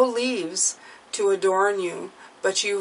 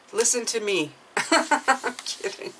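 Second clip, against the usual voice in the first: laughing; 3 semitones higher than usual. Real, not acted. Joking about her own advice.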